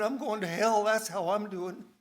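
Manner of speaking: loud, high cry of despair; despairing outburst. Retold or imitated. The prisoner answering the minister with a loud, despairing cry. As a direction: blurt out his hopelessness.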